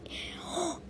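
gasp. Acted. Sudden idea for the gift.

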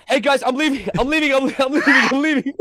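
hammed-up high-pitched I'm leaving; squeaky scared parolee. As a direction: squeal it out like a spooked runaway. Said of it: "and he's like".

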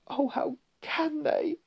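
put-on very high, slow girl's lament; distressed little girl in falsetto; tearful. A narrator's reading. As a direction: wail it softly through tears. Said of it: "tears streaming down her cheeks".

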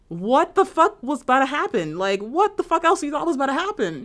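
high, emphatic exasperation; incredulous outrage. Acted. Co-host questioning the owner's logic, incredulous outrage.

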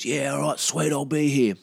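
mildly chirpy mate imitation; keen mate. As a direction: agree readily as the keen mate. Mate eagerly agrees to early start.

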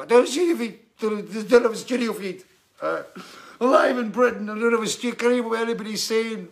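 hammed-up panic, shouting for the studio to hear; mock desperate shouting. Guest with no studio feed yells at the live camera, frantic. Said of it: "And you try to go".

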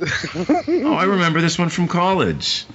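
high, hammed-up "aha" voice with a laugh; mocking the film detective. Podcast host mocking the detective's convenient memory, in a high mock voice. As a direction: lightly send up the detective's lucky recall, pitched high. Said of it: "He remembers it from college, I think he even says".

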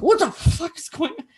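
put-on high, startled outburst as the boyfriend; a boyfriend's startled cry on seeing her face; alarmed boyfriend. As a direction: jump up in pitch, shocked at what he sees. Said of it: "is like".

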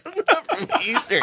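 squeaky high laugh through the words; cracking up mid-sentence; real laughter at the teasing. Real, not acted. Friend teasing about hillbilly roots.

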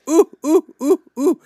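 mock moaning. Fake fever moaning.

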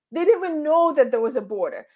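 ordinary talk

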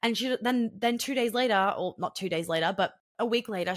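ordinary talk, nothing marked

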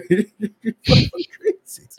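high, slow words through laughter; cracking up. Real, not acted. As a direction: say it while helpless with laughter. Cracking up at own story.

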